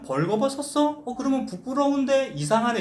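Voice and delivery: high-pitched, mildly puzzled questioning; puzzled reader. Acted. 독자가 의아해하는 속마음을 연기. (의아해하는 높은 목소리)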